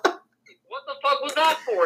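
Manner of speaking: high, slow, indignant mock outburst; high-pitched exasperated demand through laughter; retold startled protest. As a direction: act out an aggrieved friend, half laughing. Acting out startled driver's angry protest.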